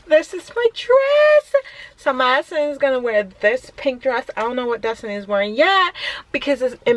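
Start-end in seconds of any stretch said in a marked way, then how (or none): 0.1-1.5 high, slow delighted reveal; excited showing off. Real, not acted. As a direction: show it off proudly. Showing off camp dress.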